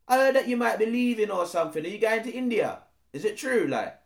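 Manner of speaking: quick, nosy street-slang voice; pry at the rumour like a roadman; a fast, lightly voiced nosy roadman.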